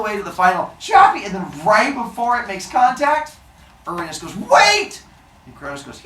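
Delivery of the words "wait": high, loud panicked cry; desperate shout. Acted. Uranus cries out to his son, high and loud. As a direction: cry out before the blade lands. Father about to be struck.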